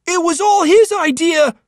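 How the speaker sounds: put-on high, loud kid throwing his friend under the bus; panicky boy blaming. Joey blaming Johnny to the monster, panicky. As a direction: blurt out the blame in a panic.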